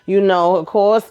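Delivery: ordinary talk, nothing marked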